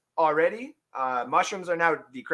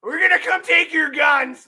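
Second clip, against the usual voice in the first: mock threat; 10 semitones higher, 7 dB louder than usual. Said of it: "No, I'm just kidding".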